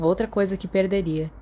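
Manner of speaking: ordinary delivery, nothing marked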